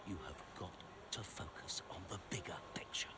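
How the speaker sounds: whispery, deliberate rebuke; hushed insistence. Acted. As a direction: press the point under your breath. Father pressing his son, low.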